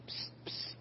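hushed teasing whisper; a playful whispered psst; mock whisper. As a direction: psst at them like a secret.

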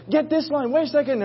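high-pitched crew scramble; mock yelling. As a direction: imitate frantic cries on a boat. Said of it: "everybody's yelling and grabbing and saying".

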